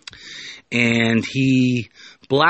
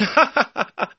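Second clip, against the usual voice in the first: high sly villain chuckle; a dirty, knowing cackle; lecherous laugh; 13 semitones higher than usual. Acted. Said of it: "And the naughty professor laughs".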